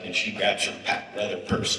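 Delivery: usual speaking voice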